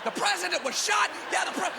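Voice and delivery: high excited yell; kid's excited yell. Retold or imitated. Boy repeating news from the hedge, high excited cry. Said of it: "I get".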